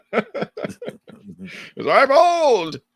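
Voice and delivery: put-on very high, drawn-out old-timer with laughter; old man voice. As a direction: stretch it out like a cackling old man. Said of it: "That's a really good old man voice".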